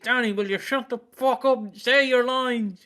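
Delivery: high-pitched, hammed-up scolding; mock outburst, laughing at the end; exasperated mock director. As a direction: play a director losing it with an actor. Imagined director losing patience with actor.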